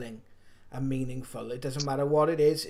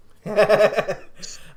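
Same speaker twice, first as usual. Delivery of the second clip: short bright laugh, higher and louder; burst of laughter; 5 semitones higher, 7 dB louder than usual. Real, not acted. Laughs at own remark.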